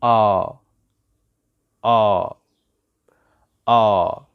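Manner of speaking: آ held as a long open "ah"; long AH sound. Shown as a demonstration. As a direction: sound out آ alone as a drawn-out "aah". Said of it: "صوت ال آ".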